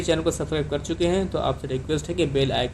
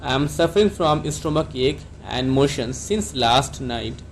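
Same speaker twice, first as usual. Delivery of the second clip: slow whole sentence; much slower than usual. Shown as a demonstration. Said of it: "आप doctor को कैसे बोलेंगे?".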